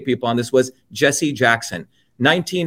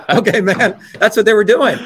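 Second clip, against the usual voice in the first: laughing; 6 semitones higher, 4 dB louder than usual. Real, not acted. Laughing, then fired up.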